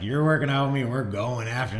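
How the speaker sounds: loud, high imitation of a pushy partner; fired-up teammate. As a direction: hype them up like an insistent workout buddy. Acts out fired-up teammate pushing him.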